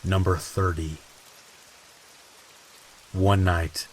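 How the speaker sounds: ordinary talk, nothing marked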